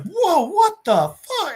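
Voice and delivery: high, drawn-out alarmed yelp; acted-out astonished reaction; startled disbelief. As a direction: blurt it out slowly in high-pitched shock. Startled guy in retold story.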